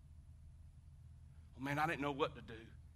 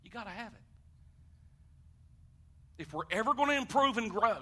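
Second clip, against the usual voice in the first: usual speaking voice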